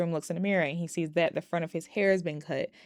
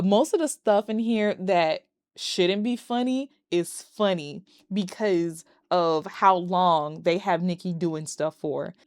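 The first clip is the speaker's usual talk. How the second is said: usual speaking voice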